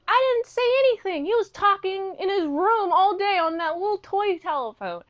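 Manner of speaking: high, acted protest from the nanny; mock-defensive high voice. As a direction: protest your innocence, pitched well up. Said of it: "she's like".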